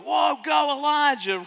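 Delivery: high, loud whoop of a fan; excited shout of "go Elijah"; cheering. Pretend. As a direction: cheer him on like a crowd at a game. Crowd cheering Elijah's victory.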